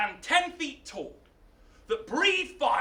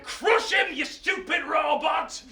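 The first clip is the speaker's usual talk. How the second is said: exasperated order; 5 semitones higher than usual. Acted. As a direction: shout the order at a dim servant. Mad king repeats order to robot.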